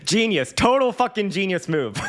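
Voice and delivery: high, squeaky-with-laughter exclamation; laughing. Real, not acted. Streamer applauding his own teenage scam, laughing hard. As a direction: crack up while praising the scheme.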